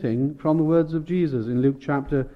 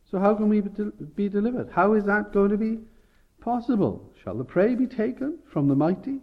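Zion pleading, voice pitched higher; anxious plea; 8 semitones higher than usual. Acted. Zion's despairing question voiced.

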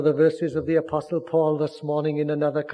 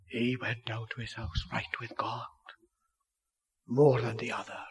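hushed, whispered assurance; 4 semitones lower, 4 dB quieter than usual. Acted. Preacher on publican forgiven, hushed whisper. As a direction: let it fall to a whisper.

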